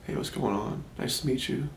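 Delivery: barely audible mumbled greeting; very quiet. Shown as a demonstration. Imitating shy young kids who turn down their voice, very quiet. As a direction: drop your voice to almost nothing. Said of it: "turn down their voice and they talk like this".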